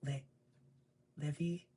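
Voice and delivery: a high, sing-song "Livy?"; sweet high call. Retold or imitated. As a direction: call out to the daughter innocently. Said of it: "she's like".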